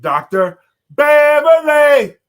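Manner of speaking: high, loud, drawn-out announcer call; slow, big hype introduction of the guest; excited announcement. Real, not acted.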